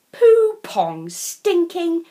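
plain reading voice

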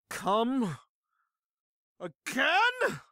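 high, drawn-out question with a long pause between words. Acted. Slumped drinker repeating the question in the bar, emphatic and slow. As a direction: stretch each word like a drunken challenge. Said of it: "this time louder".

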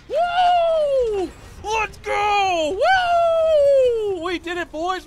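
whooping at the top of the voice; ecstatic cheering. Real, not acted. Gamer cheering the team after finishing the challenge, screaming with joy. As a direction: scream with triumph, high and loud.

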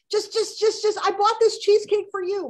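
high, loud pushy mother voice; pushy mom. Retold or imitated. Mom pressing cheesecake on her daughter, high and pushy. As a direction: wheedle like a mother who won't take no.

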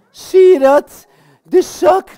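high, loud exclamation; triumphant reveal. Pretend. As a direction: show it off to the whole audience. Toont triomfantelijk de zak. (triomfantelijk roepen)

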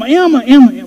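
high, excited call of the dog's name. Retold or imitated. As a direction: call her name like she's bouncing at your feet. Calling to his excited dog.

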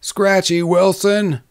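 high, drawn-out group answer; answering in chorus. A narrator's reading. Saloon men answering the drummer, as a chorus. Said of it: "they answered in chorus".

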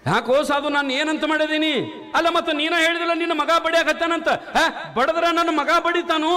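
high, ringing sermon voice; rising pulpit proclamation; fiery preaching. Acted. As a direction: preach it out to the crowd.

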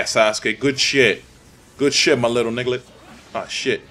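usual speaking voice

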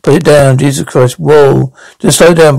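ordinary talk

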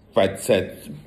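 plain reading voice

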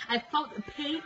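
usual speaking voice

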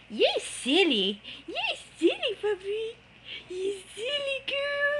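squeaky praising a dog. Real, not acted. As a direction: coo at the puppy in a tiny voice. High praise to puppy.

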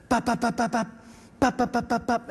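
short rhythmic bursts of gunfire sound; imitating machine gun burst. Shown as a demonstration. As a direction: mimic a five round burst, evenly spaced. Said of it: "So it sounds like this".

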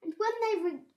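ordinary delivery, nothing marked

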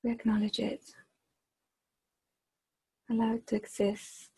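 measured meditation voice with long, unhurried pauses; guided meditation. Acted. Leader guiding a group to extend their awareness, guided meditation. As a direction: guide them gently inward, phrase by phrase, softening as it goes.

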